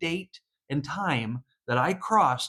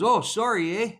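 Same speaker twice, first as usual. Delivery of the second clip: put-on Canadian accent; 6 semitones higher, 3 dB louder than usual. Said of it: "he goes".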